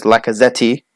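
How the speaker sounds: Lacazette ending in an extra "tee"; JJ's mispronunciation. Retold or imitated. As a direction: say Lacazette as "lacka-zetty". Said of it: "Or as J J says".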